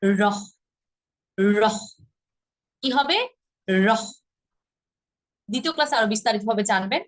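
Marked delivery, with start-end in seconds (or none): 0.0-1.8 রহ as a hard r ending in breath; like English RHO. Shown as a demonstration. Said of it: "ইংরেজি আর H O এর মতো". 3.7-4.0 রহ as a hard r ending in breath; like English RHO. Shown as a demonstration. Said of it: "ইংরেজি আর H O এর মতো".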